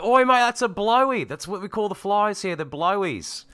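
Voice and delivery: high, loud hammed-up Aussie bloke; Australian accent. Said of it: "He's like".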